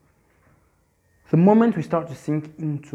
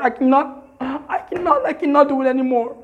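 despairing; 8 semitones higher than usual. Pretend. Voicing a staggering, defeated person.

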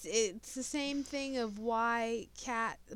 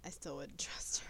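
hushed aside; whispering; 4 semitones lower, 5 dB quieter than usual. Real, not acted. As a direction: whisper it sceptically. Whispered aside about mom.

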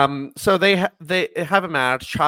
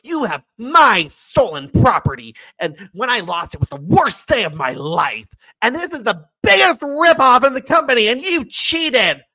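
angry rant; 8 semitones higher, 7 dB louder than usual. Retold or imitated. Chavo's angry acted rant.

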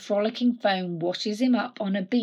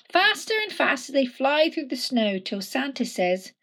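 plain reading voice

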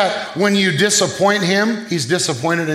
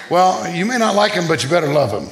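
ordinary delivery

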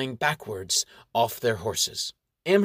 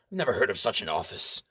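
dismissive baron; 5 semitones lower, faster than usual. Acted.

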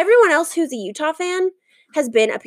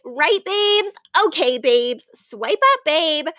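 mock Instagram influencer; 2 semitones higher, slower than usual. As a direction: parody a gushing influencer talking to followers. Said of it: "I'm gonna say things like".

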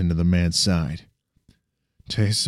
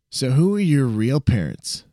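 put-on higher, amused young sidekick; cocky friend voice; 6 semitones higher than usual. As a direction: tease him, impressed but playful. Sam's voice, curious.

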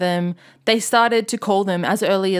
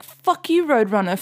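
mock angry fan; 3 semitones higher than usual. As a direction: jeer at the record label. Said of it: "Instead of being like".

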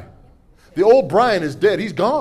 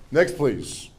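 usual speaking voice

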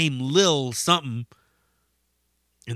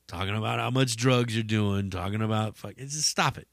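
usual speaking voice

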